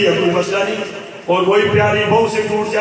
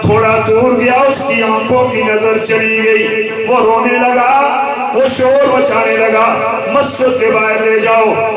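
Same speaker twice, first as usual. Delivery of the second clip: louder, sung-out sermon storytelling; preaching at full force; 2 semitones higher, 6 dB louder than usual. Acted. Preacher telling the congregation a moving story, chanting.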